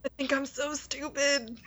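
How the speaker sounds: mock self-scolding Roger. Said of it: "And he's like".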